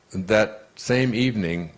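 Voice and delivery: normal talking voice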